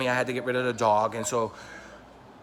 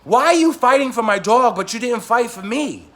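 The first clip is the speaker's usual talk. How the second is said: loud, higher wounded protest; indignant outcry; 7 semitones higher, 6 dB louder than usual. Retold or imitated. Confronting a friend who fought for the dog, loud hurt outrage. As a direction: hurl the hurt back at him.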